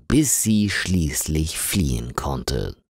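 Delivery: ordinary delivery, nothing marked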